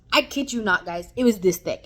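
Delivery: loud, stressing every word; loud emphatic. Real, not acted. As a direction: push it out, amazed and loud. Exaggerating the pamphlet's thickness.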